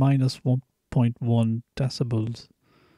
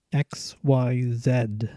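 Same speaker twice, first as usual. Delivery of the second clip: slow spelling; much slower than usual. Shown as a demonstration. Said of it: "making these noises just to see".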